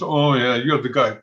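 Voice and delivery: ordinary talk, nothing marked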